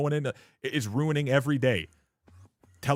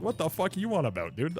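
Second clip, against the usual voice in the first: retold indignant yell; 6 semitones higher than usual. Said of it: "I was like".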